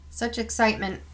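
ordinary delivery

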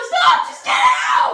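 high loud yell. Real, not acted. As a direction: yell at him to stop. Yelling at friend in bath.

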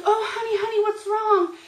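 high-pitched sympathetic cooing, imitated; imitating a worried, doting friend; concerned comforting. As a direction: coo with motherly concern. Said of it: "Olga K came up".

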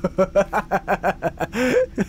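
short delighted laugh; burst of laughter. Real, not acted. Laughing at the dark trick.

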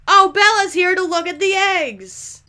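loud, high, exasperated shout; mock outrage. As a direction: announce an unwelcome customer at full volume. Mock outrage at returning customer.

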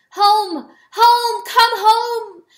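high, loud rallying cry; calling retreat. Acted. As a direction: call the animals home from far off. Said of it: "raised his voice again to cry retreat".

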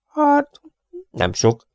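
hesitant, faltering reply; stammering. A narrator's reading. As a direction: stumble over it, unsure. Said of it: "hebegte a mérnök". (hebegő, bizonytalan válasz)

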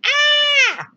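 loud high mock scream; a shouted, piercing cry of alarm; creation screaming. All creation reacting to his anger, a loud high scream. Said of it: "creation is like".

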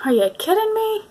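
high, disbelieving cry; incredulous. Retold or imitated. Aunt reacting to the calm goddaughter, high and disbelieving. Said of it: "I'm like".

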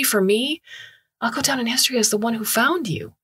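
thrilled explorer babbling excitedly. Acted. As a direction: gush with the thrill of discovery. Chul excited at discovery.

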